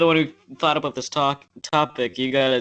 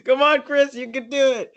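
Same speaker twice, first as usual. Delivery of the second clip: cheering on; 10 semitones higher than usual. Acted. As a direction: pump him up, high and bright. Cheering a friend on.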